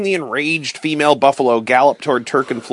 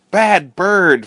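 angry scream; 6 semitones higher than usual. A narrator's reading. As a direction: snap at the bird in anger. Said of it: "Fritz screamed".

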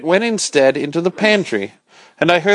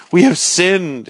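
raised, higher cry of guilt; 5 semitones higher, slower than usual. Acted. The curate cries out his sin to the narrator. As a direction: cry it out like a frantic preacher. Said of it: "he cried".

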